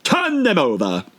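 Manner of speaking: high, slow queen's command; angry queen. A narrator's reading. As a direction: bark it at the knave. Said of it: "The queen turned angrily away".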